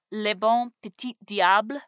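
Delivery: clumsy French accent; French name in shaky French accent; broken French. Real, not acted. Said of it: "apologize for the bad French pronunciation".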